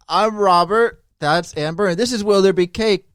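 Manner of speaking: fading mock Australian; a hint of Australian accent. Host introducing the show in a fading Aussie accent.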